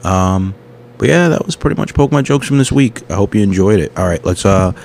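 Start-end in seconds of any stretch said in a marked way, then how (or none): none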